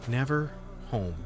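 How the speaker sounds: ordinary talk, nothing marked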